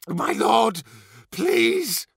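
very high, desperate plea; frightened, whimpering appeal; begging. A narrator's reading. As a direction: beg your master for mercy. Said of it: "he begged".

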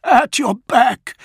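high, loud, stretched warning roar. A narrator's reading. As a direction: roar a warning to save him. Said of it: "Dantiok roared".

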